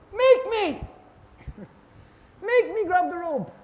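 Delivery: high, whiny mock defiance; mock petulant. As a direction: play the stubborn child who won't help himself. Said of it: "you look up and go".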